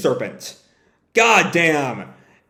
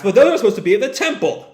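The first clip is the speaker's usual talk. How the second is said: fast, higher-pitched protest; rushed, panicky objection; alarmed reaction; 6 semitones higher, faster than usual. Acted. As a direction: blurt it out in acted alarm. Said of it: "The Empire reacts".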